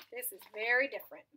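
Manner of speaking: ordinary delivery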